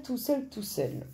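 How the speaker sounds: soft, slow, drifting repeat; fading echo. Acted. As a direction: let it fade like an echo. Echoing own words, fading away.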